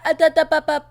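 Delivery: normal talking voice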